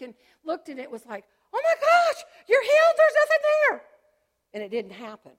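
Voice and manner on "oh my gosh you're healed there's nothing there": much higher, loud astonished cry; imagined joyful doctor. Pretend. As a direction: exclaim the miracle like a thrilled doctor. Imagined doctor announcing healing.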